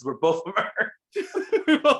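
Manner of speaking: hammed-up goofball insisting they share a planet; dopey Riddle voice. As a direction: stretch it out like a blissful goof. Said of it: "He goes".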